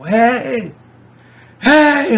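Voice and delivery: high yelling to grab attention; retold scream. Shouting across a parking lot to strangers, high yell. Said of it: "I'm screaming".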